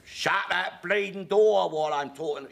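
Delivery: bully the noisy drinkers like Alf; loutish Alf. Retold or imitated. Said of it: "a bit of Alf loutishness".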